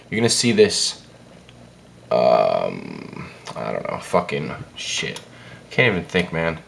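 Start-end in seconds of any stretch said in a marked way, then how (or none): 2.1-6.6 groggy, hungover mumble; slurring like a bad hangover. Real, not acted. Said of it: "I'm so hungover".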